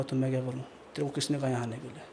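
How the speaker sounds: a lightly voiced sinister old spirit; sneering down at a begging man; cold ghost voice.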